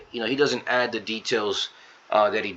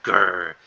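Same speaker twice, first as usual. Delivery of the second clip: louder, drawn-out imitated growl; mock growl; 2 semitones lower, 5 dB louder than usual. [name] fuming over Jack's knighthood, mock growl. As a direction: growl it out in pretend anger.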